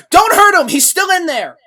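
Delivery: high, full-force yell across a room; loud, high-pitched shout from far off. Acted. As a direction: yell a desperate warning at full volume. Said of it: "Joker from across the casino just shout, yell out".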